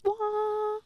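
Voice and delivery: high, sharp sung "wah"; imitated bowl tone. Copying the singing bowl's odd tone, high and sharp. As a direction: mimic a strange ringing tone.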